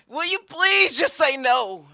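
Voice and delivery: high, loud, dragged-out begging; exasperated plea. Acted. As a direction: plead at the end of your rope. Pilate begs Jesus to deny it.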